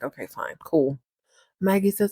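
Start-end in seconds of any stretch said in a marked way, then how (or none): 0.0-1.0 low, soft grudging mutter; mock annoyed. Annoyed Chase answering Maggie, mock annoyed. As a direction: drop it low and quiet, put-out. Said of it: "He just looks really annoyed".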